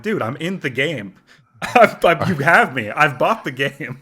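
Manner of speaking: laughing, loud and insistent. Retold or imitated. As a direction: laugh while protesting. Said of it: "I'm like".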